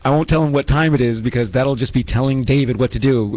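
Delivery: normal talking voice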